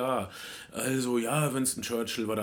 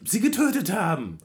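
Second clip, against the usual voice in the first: higher-pitched emphatic burst; emphatic exclamation; 7 semitones higher, 4 dB louder than usual. Real, not acted. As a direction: voice jumps up to drive the point home. Exclaims over the killing.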